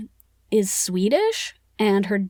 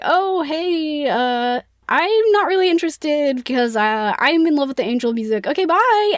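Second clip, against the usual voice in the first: breezy brush-off; 5 semitones higher than usual. Retold or imitated. Christine brushing off Raoul.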